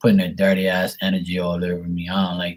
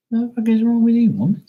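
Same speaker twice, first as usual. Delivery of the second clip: outraged yelp; 12 semitones higher than usual. Retold or imitated. Yelling at the masseuse.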